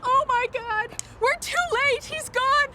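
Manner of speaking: high, distraught calling; panicked despair. Acted. Woman who thinks her date has gone, panicked. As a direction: cry out as if all is lost, pitch climbing. Arrived too late, Dave missing.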